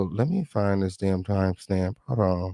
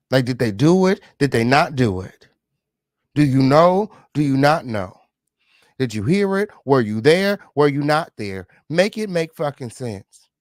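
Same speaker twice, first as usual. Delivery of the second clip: loud, high, incredulous questioning; exasperated; 4 semitones higher, 6 dB louder than usual. Real, not acted. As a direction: demand answers in disbelief.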